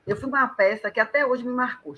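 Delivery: ordinary delivery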